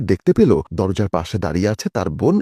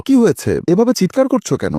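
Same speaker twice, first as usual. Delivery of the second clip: alarmed; 9 semitones higher than usual. A narrator's reading. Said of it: "তাড়াহুড়ো করে শোয়া থেকে উঠে বসে বলল".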